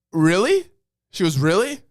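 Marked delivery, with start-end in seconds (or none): 0.1-0.7 high, loud questioning disbelief; incredulous clerk. Retold or imitated. Clerk's disbelieving reply, retold. 1.4-1.8 high, loud questioning disbelief; incredulous clerk. Retold or imitated. Clerk's disbelieving reply, repeated.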